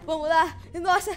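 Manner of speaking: ordinary talk, nothing marked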